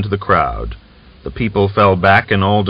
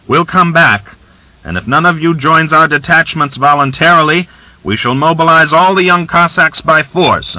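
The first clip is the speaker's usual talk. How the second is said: louder, higher jeering shout; merry shout; 7 semitones higher, 4 dB louder than usual. A narrator's reading. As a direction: shout it out with rough good humour. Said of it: "laughing, he shouted".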